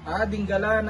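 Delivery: ordinary delivery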